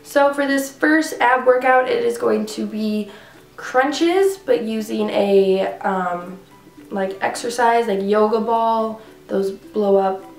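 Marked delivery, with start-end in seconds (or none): none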